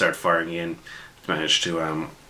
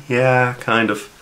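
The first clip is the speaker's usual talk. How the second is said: slow, higher, drawn-out grudging yeah; dry agreement; 6 semitones higher, 3 dB louder, much slower than usual. Retold or imitated. GM grudgingly agreeing with his players, slow and dry. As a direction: concede it with a shrug in the voice.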